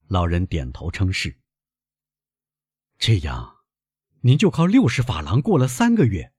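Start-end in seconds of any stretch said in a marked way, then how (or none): none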